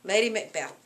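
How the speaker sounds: ordinary delivery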